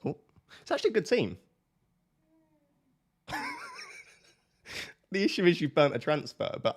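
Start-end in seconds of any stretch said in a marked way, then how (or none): 3.3-4.0 short high squeaky giggle; high laugh. Real, not acted. Streamer laughing at girlfriend's team, high giggle. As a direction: burst into a quick high giggle.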